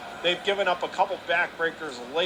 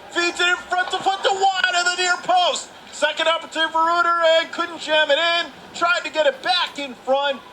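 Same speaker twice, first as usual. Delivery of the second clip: rising, louder live call of a scramble; excited call; 11 semitones higher, 3 dB louder than usual. Real, not acted. Play-by-play announcer calling a chance on net, rising excitement.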